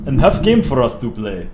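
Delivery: put-on Russian voice; Russian accent. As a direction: speak English like a hammed-up Russian. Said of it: "Do the Russian voice".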